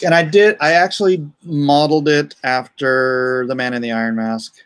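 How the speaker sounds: ordinary delivery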